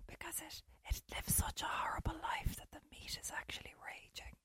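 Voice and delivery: hushed conspiratorial whisper. Real, not acted. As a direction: lean in and breathe it like a secret. Conspiratorial aside about chicken meat.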